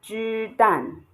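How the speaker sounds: 鸡蛋 slowly, 鸡 held level, 蛋 falling; flat then falling. Shown as a demonstration. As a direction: hold 鸡 even, then drop on 蛋. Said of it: "a flat tone and a falling tone".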